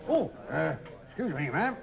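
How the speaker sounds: ordinary talk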